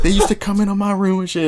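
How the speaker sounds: put-on high-pitched New Orleans accent; New Orleans local's accent. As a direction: imitate a New Orleans local telling his tale. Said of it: "I'm gonna do the accent".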